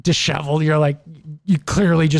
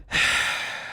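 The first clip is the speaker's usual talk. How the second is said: annoyed sigh; 8 dB quieter than usual. Retold or imitated. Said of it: "You come down and you're like".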